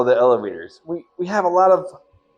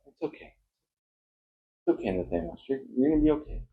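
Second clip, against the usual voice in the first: much softer, hushed reassurance; soothing hush; 10 dB quieter, faster than usual. Acted. Calming the bagged captive Nathaniel, soft and hushed.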